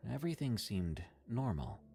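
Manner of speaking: ordinary delivery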